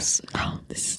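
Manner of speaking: whispered and tender; whispering. Real, not acted. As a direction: breathe it as a hushed secret.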